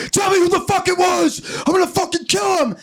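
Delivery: high-pitched furious yell; hammed-up enraged shouting; confronting. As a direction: Joseph yelling a jealous threat at his wife. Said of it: "all confronting her".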